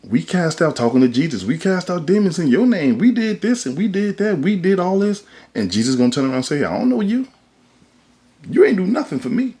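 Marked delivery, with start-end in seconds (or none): 0.0-5.2 high-pitched, mock protest to Jesus; acted-out indignant pleading. 6.6-9.5 high-pitched, acted-out disowning; mock-indignant brush-off; dismissive rejection. Acted Jesus rejecting the pleaders.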